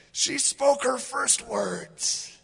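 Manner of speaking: high, slow and proud; proud excited dad. Retold or imitated. Assistant pastor telling the office his baby spoke, high and thrilled. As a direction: announce it like a thrilled new father. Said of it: "He goes".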